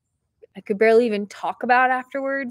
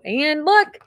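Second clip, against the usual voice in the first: high, loud burst of delight, easing off; real thrilled excitement; so excited; 6 semitones higher, 6 dB louder than usual. Real, not acted. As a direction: gush over a happy discovery. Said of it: "and I'm so excited".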